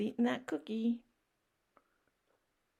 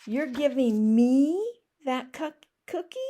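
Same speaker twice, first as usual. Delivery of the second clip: amazed pigeon; 5 semitones higher, 7 dB louder than usual. Acted.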